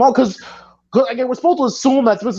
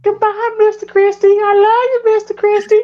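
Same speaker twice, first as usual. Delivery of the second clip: much higher, hammed-up lovestruck waitress; swooning waitress voice; 11 semitones higher, 3 dB louder than usual. Mocking the diner waitress drooling over Mister Christie, high hammed-up swoon. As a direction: gush over him like a horny diner waitress, mock breathless. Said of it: "I don't know why I'm talking like that".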